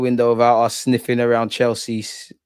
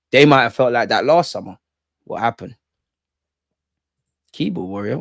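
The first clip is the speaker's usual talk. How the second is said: ordinary talk, nothing marked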